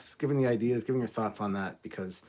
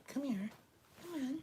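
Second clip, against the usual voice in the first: high, soft coaxing call; soft dog call; 9 dB quieter than usual. Real, not acted. Calling the scared dog past the camera, soft high coaxing. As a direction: coax the shy dog, higher and softer.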